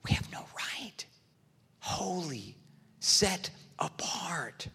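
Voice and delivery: slow, awed whisper; hushed reverence. Real, not acted. Preacher to congregation on God's holiness, whispered awe. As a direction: whisper it, leaving space between phrases.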